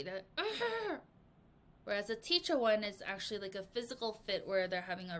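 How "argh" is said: sharp high frustrated "argh"; a kid's angry outburst sound; angry growl. Shown as a demonstration. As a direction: let out a tantrum cry. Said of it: "they're angry".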